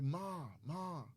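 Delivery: mock plea, calling out to mom without volume. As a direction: imitate calling upstairs for help. Retelling calling upstairs for mom.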